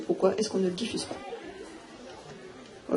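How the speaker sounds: breathy slow whisper, drawn out. Real, not acted. A speaker asks the audience why, in a slow whisper. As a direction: drop to a slow pointed whisper. Rhetorical question dropped to a whisper.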